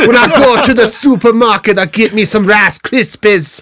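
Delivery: high, loud Jamaican attempt drifting Scottish; botched Jamaican with a Scottish tail; attempted Jamaican accent. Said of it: "it sounds Scottish though".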